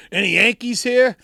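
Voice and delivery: high, loud call to the audience; calling out to crowd. Retold or imitated. Singer calling out to the Austin crowd, loud and high. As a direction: shout it out from the stage.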